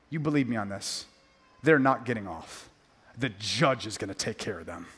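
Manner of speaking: a quiet promise of judgment; hushed solemn warning. Acted. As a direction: drop the voice right down, grave and low. Preacher drops to grave hush.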